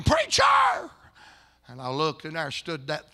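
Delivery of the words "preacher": very high, loud imitated scream; retold scream. Wife screams after the pastor at his car, piercing cry. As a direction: scream out for the preacher. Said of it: "I heard a scream".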